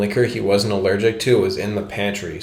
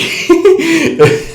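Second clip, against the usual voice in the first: laugh; 6 dB louder than usual. Real, not acted. Laugh after impression.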